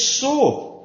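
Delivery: "So." lifted high and loud to seize attention; emphatic So. Shown as a demonstration. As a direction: ring out "So." as the turning point. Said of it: "But he says".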